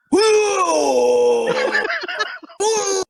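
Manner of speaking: loud, high, drawn-out retching whoop; imitated puking howl. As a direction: mimic over-the-top vomiting. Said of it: "just the noises you make".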